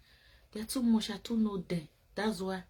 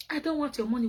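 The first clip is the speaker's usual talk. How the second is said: mocking "I don't want your money," pitched up; mocking quote; 7 semitones higher, faster than usual. Mocking the men who say they want no money from women. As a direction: mimic the lying man with a light sneer. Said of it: "This is how they talk".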